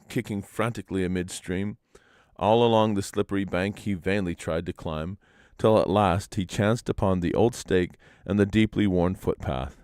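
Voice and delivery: ordinary delivery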